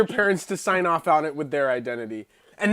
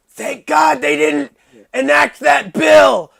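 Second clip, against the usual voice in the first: high, loud hammed-up wail; mock-tragic, strained deathbed shouting; dying man's lament; 7 semitones higher, 6 dB louder than usual. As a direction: play a dying man's last impassioned cry. Plays the dying climber again.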